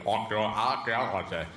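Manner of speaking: "archaeology" in odd country accents; made-up regional accents. Shown as a demonstration. Touring the country's counties, invented regional accents. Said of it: "As you go around the country".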